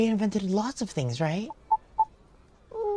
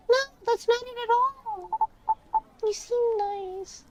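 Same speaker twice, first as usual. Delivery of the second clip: put-on high-pitched nine-year-old; high little kid voice; 14 semitones higher than usual. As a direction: reassure like an earnest little child. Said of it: "I'm reading in this kid voice".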